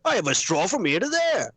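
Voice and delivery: high, slow theatrical movie-villain voice; hammed-up film quote impression; Daniel Day-Lewis. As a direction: draw out each phrase, pitched well up. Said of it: "Yeah, he's like".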